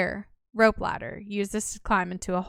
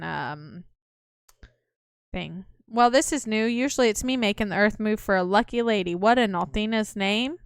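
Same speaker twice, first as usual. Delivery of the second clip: ordinary talk, nothing marked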